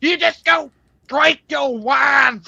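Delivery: loud, high, drawn-out taunt; high-pitched mocking yell. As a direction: holler it slowly, high and hard. Mocking retold quote of mother.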